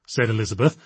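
usual speaking voice

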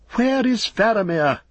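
high, anguished call; dismayed, pitch leaping up; cry of dismay. A narrator's reading. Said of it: "cried Beregond in dismay".

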